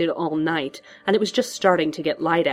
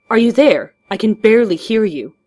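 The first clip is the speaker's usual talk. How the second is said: raised voice, higher and louder; calling out; 5 semitones higher, 8 dB louder than usual. A narrator's reading. Man calls butler over crackling headset, calling out. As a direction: shout down a bad phone line.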